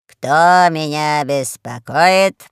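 slow, drawn-out demand; languid, lordly annoyance; imperious boss. A narrator's reading. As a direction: ask it heavily, stretching the words. Lazy boss answers the call.

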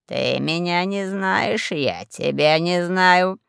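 slow, drawn-out instruction; deliberate, stretched-out warning; lordly warning. A narrator's reading. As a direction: spell it out slowly to a brother. Boss lays down the rule.

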